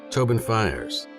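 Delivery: ordinary delivery, nothing marked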